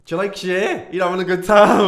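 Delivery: loud, high, over-the-music shout; calling out. Retold or imitated. Chatting up a woman dancing to [name], calling out. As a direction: holler it brightly to a stranger.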